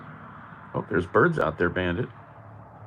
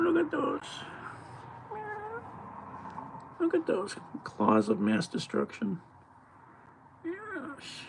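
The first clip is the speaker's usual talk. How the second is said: very high, soft pet-talk voice; sing-song high voice for the kitty; 8 dB quieter than usual. Real, not acted. Talks to cat in pet voice.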